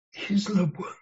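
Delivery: soft tender whisper of reassurance; hushed and tender. Real, not acted. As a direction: breathe the words to them. Tender aside to the congregation.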